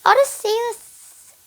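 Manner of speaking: high, loud, drawn-out name; proud name reveal. Acted. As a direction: sing out the name boastfully. Boastful reveal of his name.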